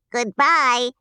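cheerful chorus goodbye. Acted. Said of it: "said the three little Munchkins".

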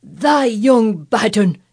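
slow, loud, drawn-out scolding; outraged calling down. Acted. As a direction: call down in outrage. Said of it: "he called down at her".

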